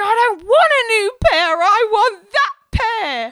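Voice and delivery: high, loud, hammed-up wailing; crying tantrum. Crying pregnant woman demanding her old boots back, mock tantrum. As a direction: wail like a child denied, stretching the last words. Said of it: "And I'm crying".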